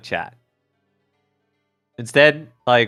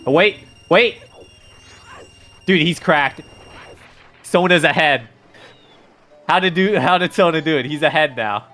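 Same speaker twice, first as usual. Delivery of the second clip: hyped gamer bro; 5 semitones higher than usual. Real, not acted. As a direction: call the race like a hyped streamer. Excited live race commentary.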